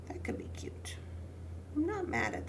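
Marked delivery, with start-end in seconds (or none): 0.1-1.0 soft whisper of approval; whispered aside. Real, not acted. Crafter eyeing a layout, whispering approval. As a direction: breathe it out as a private thought.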